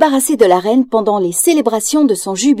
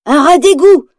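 higher, louder accusing cry; unmasking denunciation; 6 semitones higher, 5 dB louder than usual. Acted. Basil unmasking Ratigan before the crowd, loud accusation. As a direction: expose him to the crowd.

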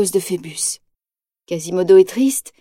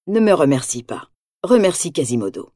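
low captain voice; 7 semitones lower, 3 dB quieter than usual. A narrator's reading. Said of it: "répond le capitaine".

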